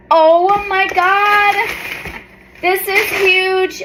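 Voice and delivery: slow, high, awestruck; awed. Real, not acted. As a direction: let the awe slow you down. Overwhelmed by the bookmark.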